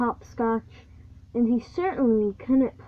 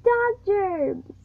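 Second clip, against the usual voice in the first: high, loud cry of disgust; Lucy screaming at the dog; 9 semitones higher, 5 dB louder than usual. A narrator's reading. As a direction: shriek "Dog germs!" as Lucy. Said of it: "she screamed".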